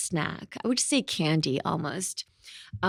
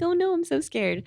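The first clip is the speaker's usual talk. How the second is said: much higher mock-frightened voice; mock scared; 11 semitones higher than usual. Speaker imitates members frightened by her huh, mock scared. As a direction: imitate timid bandmates getting scared. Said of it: "they used to think I was really scary".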